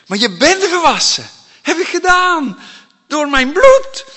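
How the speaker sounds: loud, high preaching proclamation; emphatic declaration. Acted. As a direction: proclaim it with full force. Voices Jesus declaring emphatically.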